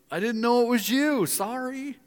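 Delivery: high-pitched, hammed-up excuse; mock flustered apology. Scolded believer apologizing to God, mock flustered. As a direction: plead innocence in a squeaky-high voice.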